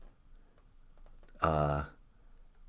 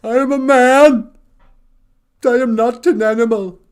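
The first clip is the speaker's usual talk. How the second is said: high, loud desperate cry; anguished plea; 14 semitones higher, 11 dB louder than usual. Retold or imitated. Cornered monster begging the mob, a loud anguished plea. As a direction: plead for his humanity. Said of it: "And he goes".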